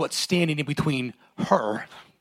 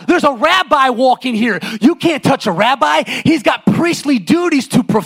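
higher, louder indignant scolding; freaked-out crowd; 8 semitones higher, 6 dB louder than usual. Retold or imitated. Crowd rebuking the unclean woman, indignant and raised. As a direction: snap at her like an outraged bystander. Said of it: "freaked out and said".

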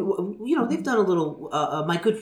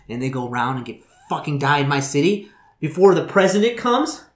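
furious; 7 dB louder than usual. Acted. Woman ranting about her city before the president comes, increasingly furious. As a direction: start measured and end yelling the curses.

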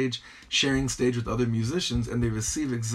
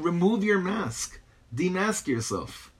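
higher, slow acted command; Rebbe addressing nature; 8 semitones higher, slower than usual. Rebbe talking to nature, slow mild command. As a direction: slowly order nature, lightly acted. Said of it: "A Rebbe is talking to nature, and he's saying".

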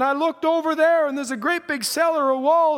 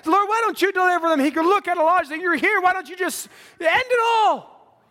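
raised, higher, urgent challenge; impassioned pleading; 5 semitones higher than usual. Acted. Man asking the Lord to deliver them, impassioned pleading. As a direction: build from a pleading question to a shout.

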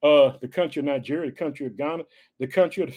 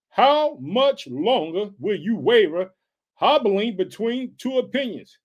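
slow, raised preacher's Bible reading; scripture reading; 5 semitones higher, 5 dB louder than usual. Acted. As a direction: read the verse out to the flock, slowly and high.

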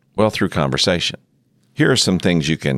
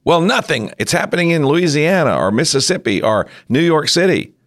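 much higher, a bit louder complaint; exasperated reply; 11 semitones higher, 3 dB louder than usual. Retold or imitated. Ranter telling the counselor nothing can be done, exasperated. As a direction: toss it off helplessly. Angry person answers key question.

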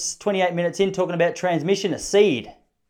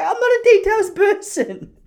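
much higher, louder, slow put-on voice; silly squeaky excuse; 6 dB louder, slower than usual. Caught out by his wife, high squeaky excuse. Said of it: "I go".

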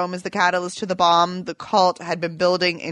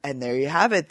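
normal talking voice

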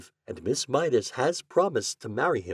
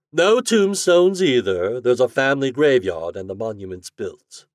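butler cutting in; 3 semitones higher, 9 dB louder than usual. Acted. Butler interrupts the glassblower, loud and dismissive. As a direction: shut him down before he speaks. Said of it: "the butler interrupted him".

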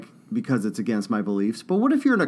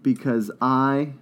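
ordinary talk, nothing marked

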